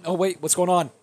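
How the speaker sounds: ordinary talk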